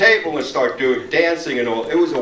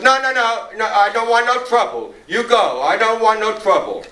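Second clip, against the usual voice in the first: higher-pitched, insistent waiter; agitated refusal; 7 semitones higher, 3 dB louder than usual. Retold or imitated. Greek waiter refusing the kid coffee, imitated agitated refusal. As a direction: imitate a waiter anxiously waving someone away.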